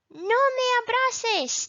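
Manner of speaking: high, loud pretend refusal; mock protest. Acted example of refusing a hug.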